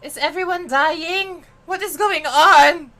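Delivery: stunned by what's happening on screen; shocked. Real, not acted. Streamer asks viewers in disbelief, slow and loud.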